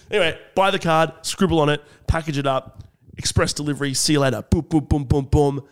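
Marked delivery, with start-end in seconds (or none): none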